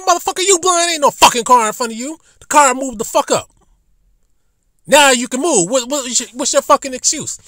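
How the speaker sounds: high and loud, yelling through the car window; retold angry shouting. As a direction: tear into the driver who won't move. Retold shouting match with driver.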